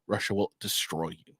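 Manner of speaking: usual speaking voice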